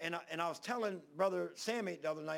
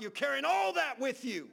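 preaching shout; 7 semitones higher, 6 dB louder than usual. Real, not acted. Preaching climax to congregation.

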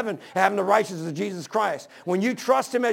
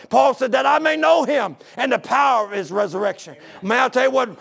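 higher, forceful pulpit delivery; impassioned preaching; 4 semitones higher, 4 dB louder than usual. Real, not acted. Preacher urging listeners that they can know God, impassioned preaching. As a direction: preach it out in raised, driving phrases.

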